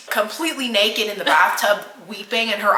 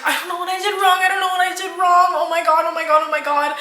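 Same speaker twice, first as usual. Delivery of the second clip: high, panicked retold babble; frantic; 8 semitones higher, 3 dB louder, faster than usual. Imitating girlfriend not making sense on FaceTime, frantic and high. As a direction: tumble through it repeatedly in imitated panic. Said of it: "She's not making a whole lot of sense".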